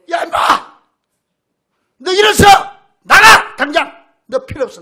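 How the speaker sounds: pastor's pretend outburst at the man in the hat, furious shout; imagined furious yell. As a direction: blast him out of the church at full volume. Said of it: "요래고 싶은 생각이".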